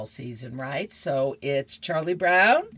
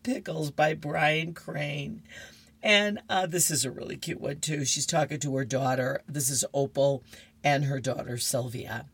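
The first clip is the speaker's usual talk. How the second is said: usual speaking voice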